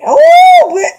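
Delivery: high, loud exclamation; sudden squeal of excitement; excited outburst. Real, not acted. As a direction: burst out with it.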